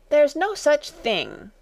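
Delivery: high, drawn-out indignant protest; very angry. A narrator's reading. Alice disputing the treacle well, angry. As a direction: contradict angrily, slow and high. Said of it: "very angrily".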